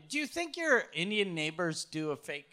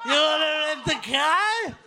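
loud, high, drawn-out mock voice; hammed-up ugly-customer impression; fat, ugly person; 9 semitones higher, 8 dB louder, slower than usual. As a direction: bellow it slowly as a grotesque mimic. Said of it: "ugly person, you're like".

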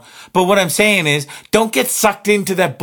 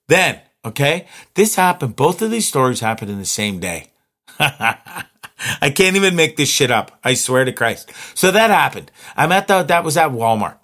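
normal talking voice

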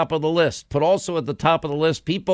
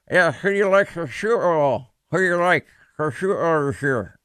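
mumbling "who do you like for sure" through a gaping mouth; mouth wide open. Retold or imitated. Patient answering the dentist mid-drilling, slurred open-mouthed mumble. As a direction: talk as if stuck in the dentist chair, jaw open. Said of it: "my mouth is wide open with the drill blasting".

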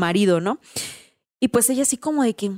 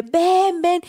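retold yell; 8 semitones higher than usual. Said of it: "empieza a gritar a la morra".

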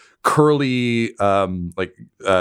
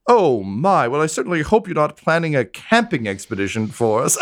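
high, sneering, amused voice; snooty aristocrat; 8 semitones higher than usual. Acted. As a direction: look down your nose at them. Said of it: "just goes".

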